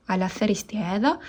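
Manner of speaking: ordinary talk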